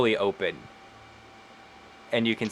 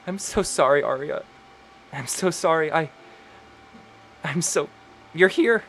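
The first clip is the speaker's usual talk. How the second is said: higher, choked-up plea; tearful apology; 7 semitones higher than usual. Acted. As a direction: apologize through tears. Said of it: "Lenore just starts weeping".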